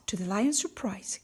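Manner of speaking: ordinary delivery, nothing marked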